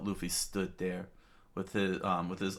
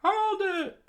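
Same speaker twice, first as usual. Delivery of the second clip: high, loud shout to stop someone; a crowd yelling stop; 7 dB louder than usual. Acted. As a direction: yell it to halt them. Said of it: "the townspeople screamed out".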